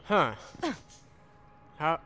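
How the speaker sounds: ordinary talk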